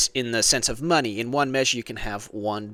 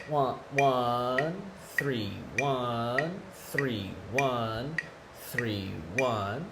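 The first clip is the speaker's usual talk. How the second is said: softer counting of the rhythm; half and quarter rhythm count; 6 dB quieter, much slower than usual. Shown as a demonstration. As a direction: count the half note long then the quarter. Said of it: "So that would sound like this".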